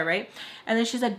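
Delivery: ordinary delivery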